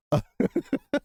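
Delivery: sudden high cackle breaking through; high burst of laughter. Real, not acted. Laughing at a headline while reading to viewers. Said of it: "I'm sorry that I'm laughing right now".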